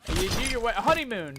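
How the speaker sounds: ordinary delivery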